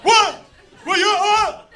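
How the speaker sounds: high, loud incredulous "what?"; mock-outraged brother, high and loud; brother's baffled outburst. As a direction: shriek the disbelief up high. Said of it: "And he goes".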